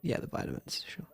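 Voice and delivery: low whispered aside; whispered sarcasm. Real, not acted. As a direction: mutter it under breath.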